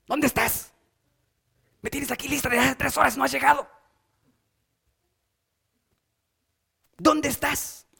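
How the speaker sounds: mock angry nagging. Shown as a demonstration. Wife waiting three hours scolding her husband, mock angry. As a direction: scold like a wife kept waiting, lightly faked. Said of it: "a mí no me sale lo de gritar".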